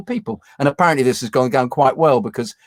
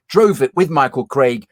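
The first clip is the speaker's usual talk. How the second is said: normal talking voice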